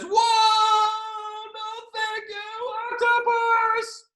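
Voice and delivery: very high, drawn-out cry; alarmed boy's wail. Acted. Boy blown dry by the tuba, high slow comic yell. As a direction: wail it as if being blasted away.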